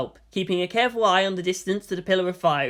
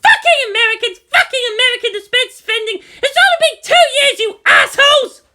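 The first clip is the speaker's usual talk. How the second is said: much higher, much louder furious shout; furious scream; 11 dB louder than usual. Acted. Misato screaming at the screen, furious. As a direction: scream your rage at the screen. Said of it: "Misato screamed out at the screen".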